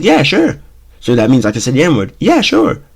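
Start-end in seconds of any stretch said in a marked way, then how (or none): 0.0-0.6 high sarcastic yeah sure. Retold or imitated. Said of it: "sarcastic manner as possible". 2.2-2.8 high sarcastic yeah sure. Retold or imitated. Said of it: "sarcastic manner as possible".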